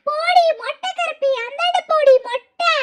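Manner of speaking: high mocking ghost voice; taunting ghost. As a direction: jeer at her in a high put-on voice.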